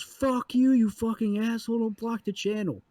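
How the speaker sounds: high, loud angry tirade; dad yelling at the guide across the water. Retold or imitated. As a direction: cuss out the blocking guide. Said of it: "holds up both his middle fingers and goes".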